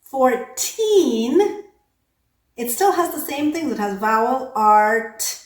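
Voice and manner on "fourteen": put-on "Fourteen" with a long, rising TEEN; second syllable stressed. Shown as a demonstration. Teacher explaining the exception, "Fourteen" stressed on TEEN. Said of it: "the second vowel is stressed".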